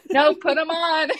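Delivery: high, slow, stubborn demand; insistent. Retold or imitated. Insisting the nurse put on her socks, high and stubborn.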